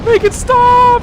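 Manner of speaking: high, loud panicked scream. Real, not acted. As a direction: shriek it in real panic. Swarmed, panicking in boss fight.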